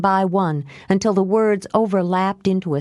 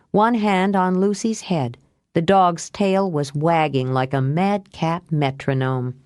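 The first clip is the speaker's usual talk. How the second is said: usual speaking voice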